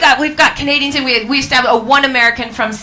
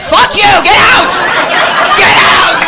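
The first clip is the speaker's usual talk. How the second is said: loud, high hammed-up outrage; mock furious shout; 8 semitones higher, 9 dB louder, slower than usual. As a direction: bellow as if throwing someone out. Acting out hostile Scottish reaction to Brexit.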